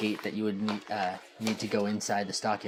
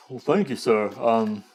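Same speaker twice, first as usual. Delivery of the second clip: dopey guard voice; 3 semitones higher, 6 dB louder than usual. Acted.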